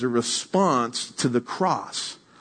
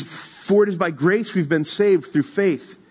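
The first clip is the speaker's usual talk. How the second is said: raised, slow emphatic reading; scripture reading; 4 semitones higher than usual. Acted. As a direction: proclaim the verse with weight. Reads scripture aloud.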